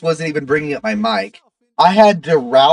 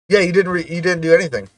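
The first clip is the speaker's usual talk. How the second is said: normal talking voice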